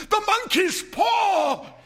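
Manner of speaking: high, loud, sudden cry; wild cry. A narrator's reading. Wife waking her husband with a wild, high cry. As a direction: cry it out wildly in the night. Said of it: "she cried wildly".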